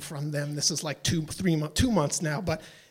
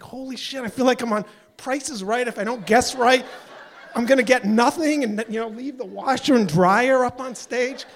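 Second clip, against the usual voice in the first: worked up; 5 semitones higher, 4 dB louder than usual. Retold or imitated. Feels like game show final guess.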